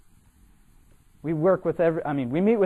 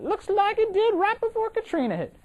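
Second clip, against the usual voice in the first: very high-pitched mock Cajun woman; Cajun accent impression. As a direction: squeal it out in a hammed-up Cajun voice. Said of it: "butcher a Cajun voice".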